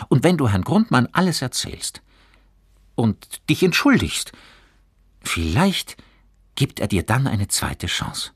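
put-on encouraging boy. A narrator's reading. Johannes suggests apologising to the teacher, encouraging. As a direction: build toward a hopeful offer. Reader voices Johannes advising.

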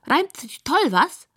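high, squeaky pig pleased with the rhyme; high piggy voice; squealing pig. A narrator's reading. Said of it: "quiekt es von der Couch".

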